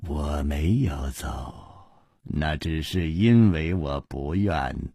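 low laugh into the words; amused defiance. A narrator's reading. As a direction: laugh then speak low. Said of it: "南宫灵也大笑".

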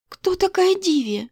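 high, slow puzzled question; bewildered. Acted. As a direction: ask in startled confusion. Alisa bewildered, very high pitch.

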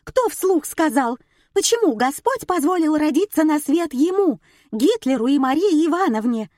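high, shrill tirade; angered wife. A narrator's reading. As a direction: rail at the husband in a high voice. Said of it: "Запоздало разозлилась супруга". (разозлённая жена, на повышенных тонах)

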